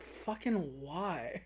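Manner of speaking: very slow, soft, dragged-out whine; drawn-out whine. Retold or imitated. Bond reluctant as Vesper heads to the bank, whining slowly. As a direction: stretch it out like a sulky groan.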